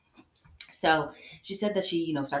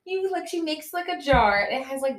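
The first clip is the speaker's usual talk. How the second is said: high, giggling through the words; laughing while talking; 10 semitones higher than usual. Real, not acted. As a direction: laugh as you explain it.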